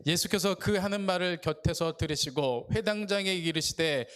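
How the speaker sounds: usual speaking voice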